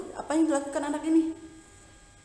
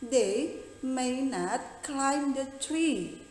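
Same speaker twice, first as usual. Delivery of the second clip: slow model sentence; much slower than usual. Shown as a demonstration. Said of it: "tapi pasti kamu mengatakan".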